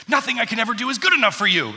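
high, loud, fast mock tantrum; child's angry outburst. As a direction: imitate an exasperated little kid blowing up. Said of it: "storms out of the room".

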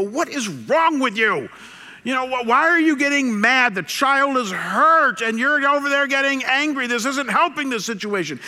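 high, loud reproach; scolding wife. Retold or imitated. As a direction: imitate an exasperated wife telling off her husband. Wife scolding husband, acted.

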